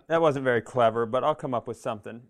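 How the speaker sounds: ordinary talk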